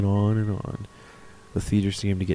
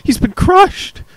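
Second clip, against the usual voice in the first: much higher, loud horrified cry; anguished cry; 9 dB louder, slower than usual. Acted. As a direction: wail it like a stunned onlooker. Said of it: "Another voice cried out".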